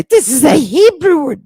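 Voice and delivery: high, loud mock protest; mock objection. Host voicing listeners who object about the word, mockingly. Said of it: "And you're gonna say".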